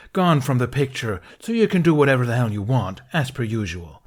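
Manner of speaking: loud, rushed angry jab; angry accusation. Acted. Ben accusing Qui-Gon of doing whatever he wants, rushed and angry.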